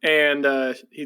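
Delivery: normal talking voice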